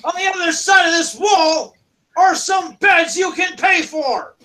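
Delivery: high shouted directions; irritated kobold guard shouting; impatient guard. Acted. Guard points to tavern beds.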